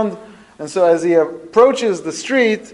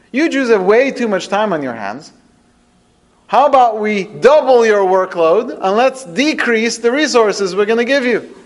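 scornful ruler, pitched up and emphatic; Pharaoh; 6 semitones higher, 4 dB louder than usual. Retold or imitated. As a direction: act out a tyrant's mocking rebuke. Voicing Pharaoh rebuking Moshe.

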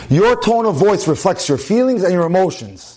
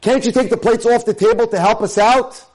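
higher-pitched mock-annoyed wife; exasperated, raised-pitch complaining question; angry tone; 6 semitones higher than usual. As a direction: act out a wife's irritated request. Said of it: "may say in an angry tone of voice".

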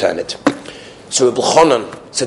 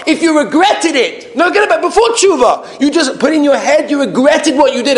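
insistent sermon delivery, climbing higher; impassioned preaching; 12 semitones higher than usual. Real, not acted. Rabbi teaching that regret alone counts, impassioned preaching. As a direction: drive the teaching home forcefully.

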